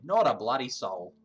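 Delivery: put-on a light Australian accent on the line. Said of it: "now Harry's Australian".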